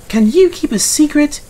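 high, loud sly engine voice; conspiratorial Mavis. A narrator's reading. Mavis plotting with the trucks, high and sly. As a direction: let them in on a scheme, high.

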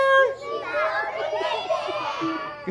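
squealing high laugh. Real, not acted. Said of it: "laughs after baby voice".

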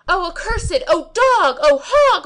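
high, very loud curses; angry insults. A narrator's reading. Servants cursing the Kazi, high and very loud. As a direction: hurl the insults. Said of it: "my servants replied".

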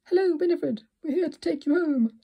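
excited cry. A narrator's reading. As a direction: call out to her, thrilled. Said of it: "they cried".

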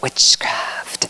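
witchcraft breathed in a hushed whisper; imitating the lady's spooky whisper. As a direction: breathe witchcraft like a dark secret. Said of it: "That's how she said it".

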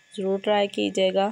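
ordinary talk

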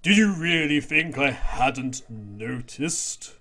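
slow, drawn-out sinister line; slow menacing reveal. Acted. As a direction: let the threat hang.

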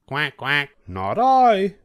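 a duck quacking then refusing. A narrator's reading. Duck refusing to help the hen in a high voice. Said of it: "said the duck".

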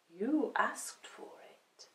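slow, hushed cat voice; quiet, ominous cat; warning cat. A narrator's reading. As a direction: warn slowly, almost whispered.

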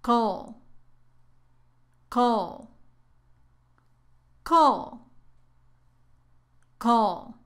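"Call" as a short middle syllable of chocolate, vowel nearly gone; isolated drill of "Call", like a brief cl; barely sounded O. Shown as a demonstration. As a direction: repeat "Call" alone, long pauses between. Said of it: "la O casi no se pronuncia".

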